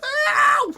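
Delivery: straining for a very high shriek. Retold or imitated. Puzzling over the singer's range, screamed high. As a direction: push the scream as high as it goes. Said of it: "How does he get".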